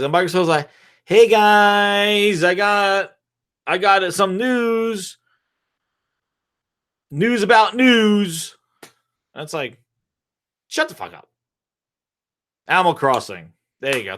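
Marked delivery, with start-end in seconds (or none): 1.0-8.6 high, slow, drawn-out mock voice; mock Microsoft. Company butting into rivals' fight with news, high mocking voice. 10.7-11.3 low, softer mock put-down; dismissive retort. Rivals to the interrupting company, low dismissive retort.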